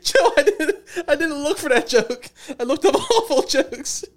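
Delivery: high, squeaky words pushed out through laughing tears; crying with laughter. Real, not acted. After the dark joke, to Joe, cry-laughing and high. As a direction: laugh so hard it turns into crying. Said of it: "I'm crying".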